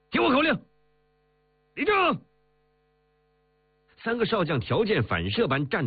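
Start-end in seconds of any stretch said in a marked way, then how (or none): 0.1-2.2 very high-pitched parade-ground order; barked command. A narrator's reading. Marshal calling three generals to attention, barked command. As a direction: bark it out like a drill command. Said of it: "他喝道".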